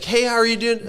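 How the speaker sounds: high, over-chirpy greeting; mock your own awkward sales opener; nervous greeting. Greeting gym staff during the botched pitch, high and chirpy.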